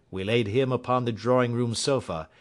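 ordinary delivery

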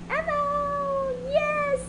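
high, bouncy excited announcement. Real, not acted. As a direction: pitch it way up with glee. Announces the game, thrilled.

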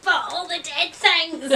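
laughing through it; giggling defence of dead things; mock plea while laughing; laughing protest. Retorting to mum, laughing.

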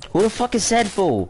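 high-pitched incredulous retort; indignant, voice jumping high. Real, not acted. Indignant retort during banter.

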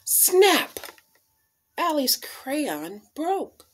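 plain reading voice

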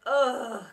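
loud fed-up groan; kids' groan. A narrator's reading. Children groaning at the teacher, fed-up. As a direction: groan in dismay. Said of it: "groaned the children".

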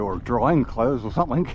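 ordinary talk, nothing marked

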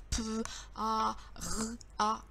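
"P, A, R, A" as separate speech sounds, not letter names. Shown as a demonstration. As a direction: sound out "P, A, R, A" one by one with pauses. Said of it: "ça fait beaucoup de sons à se dire". (« P, A, R, A » dits son par son, séparément)

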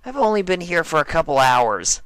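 defensive denial as Dash; mild defensive Dash; Dash denying. Acted.

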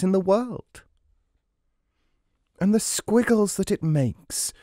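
high, thrilled little ant voice; excited cry. A narrator's reading. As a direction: gush over it, full of wonder. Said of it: "cried the ant".

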